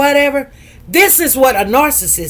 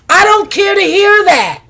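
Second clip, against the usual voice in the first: loud, high, emphatic rejection; fed-up retelling with raised voice; indignant retort; 7 semitones higher, 6 dB louder than usual. Retold or imitated. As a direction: shut the excuses down forcefully. Angrily rejects nurse's excuses.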